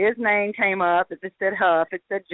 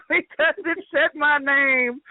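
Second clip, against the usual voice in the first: high, drawn out through laughter; laughing; 6 semitones higher, slower than usual. Real, not acted. Explaining the lost bet to listeners, laughing. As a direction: laugh your way through the words.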